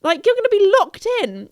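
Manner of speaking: loud, high, exasperated plea; urgent warning. Retold or imitated. Phoning friends trapped in the shop, urgent shout. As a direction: shout at oblivious friends to move.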